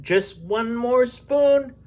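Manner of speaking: high, slow coaxing mother; coaxing parent. Acted.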